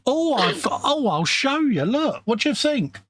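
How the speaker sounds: put-on high, excited old dear showing off her work; proud cleaning lady. Old dear proudly showing the bishop her restoration, high excited voice. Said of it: "when she went".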